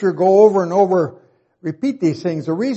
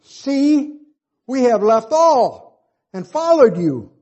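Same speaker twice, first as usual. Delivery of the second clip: Peter speaking; 7 semitones higher, slower than usual. Retold or imitated. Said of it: "Then Peter began to say to him".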